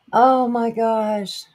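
high, slow, overjoyed gasp; excited. Real, not acted. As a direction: stretch it out in delight. Overjoyed return to stream.

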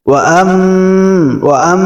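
আম with a humming held m; আম ending in a nasal hum; nasal ghunna. Shown as a demonstration. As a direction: hum the ম of আম through the nose. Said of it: "আম গুন্না হবে".

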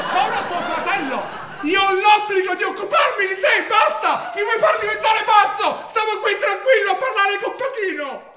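high, loud outburst at the brother on the phone; furious shout. Acted. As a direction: lose it completely and yell. Brother explodes at caller.

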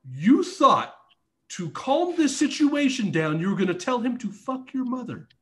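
retold incredulous telling-off; incredulous scolding. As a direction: question your partner's logic in exasperation. Scolding his partner in disbelief.

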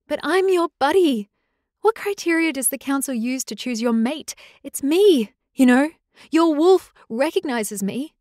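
high, loud, pleading cry; pleading, near tears; desperate plea. Acted. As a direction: fight to be chosen, voice rising. Rosalie argues desperately with mate.

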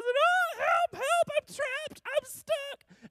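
put-on squeaky high little-kid voice crying for help; panicked cry for help. Child stuck on the garage door calling to dad, high panicked squeal. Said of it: "and I was nervous".

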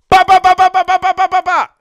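loud, high rapid-fire shooting noise; spray the room with mouth gunfire. Retold or imitated. Firing the ballpoint-pen blowpipe. (bruit de rafale crié, aigu et fort)

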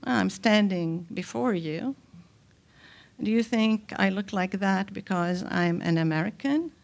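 ordinary delivery

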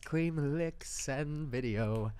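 each word set apart, slow; slow listed recap. Shown as a demonstration. Recapping the story for her friend, word by word. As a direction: spell it out word by word, slowly. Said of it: "I don't like the way that you've worded that".

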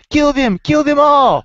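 high, pumped-up trooper battle cry; rally the troops to kill the bugs; Casper Van Dien voice. Pretend. Co-host requested a movie-hero impression, gung-ho rallying yell. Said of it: "in a Casper Van Dien voice".

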